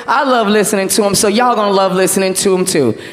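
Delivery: ordinary talk, nothing marked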